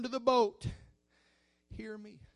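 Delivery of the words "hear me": ordinary talk, nothing marked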